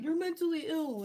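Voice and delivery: high, loud sneering impression of critics; mock the people who insult her online; mocking dumb troll voice. Mocking online critics who call her mentally ill.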